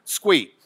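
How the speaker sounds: squeak, the Lubbock slur of let's go eat. Shown as a demonstration. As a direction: squeak said as one clipped word. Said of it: "That's Lubbock for".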